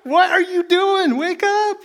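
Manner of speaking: high, frantic cry of panicked disciples. Retold or imitated. Disciples in the storm wake Jesus in high panic. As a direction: shriek it like terrified men in a sinking boat. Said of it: "they're like, freaking out".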